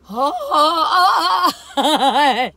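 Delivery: loud burst of laughter; loud laughing fit; big laugh. Real, not acted. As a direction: crack up before singing. Bursts out laughing before song.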